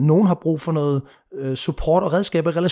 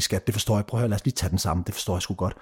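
soft, lower and tender, like calming a partner; gentle apology; 8 semitones lower, 5 dB quieter, faster than usual. Retold or imitated. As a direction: ease her frustration gently and quietly. Voices gentle reply to girlfriend.

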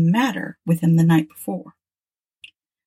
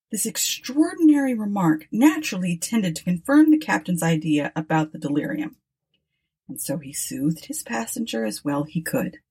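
ordinary delivery, nothing marked

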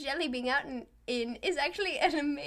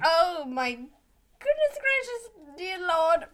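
disgusted outcry; 2 semitones higher, 8 dB louder, slower than usual. Real, not acted. Grossed out by the jelly bean.

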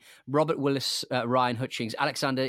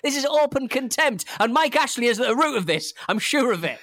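high, worked-up comic outrage; indignant rant; 7 semitones higher, 3 dB louder than usual. Real, not acted. Podcast guest tells co-hosts the film-makers despise fans, indignant. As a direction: accuse them with raised, outraged voice.

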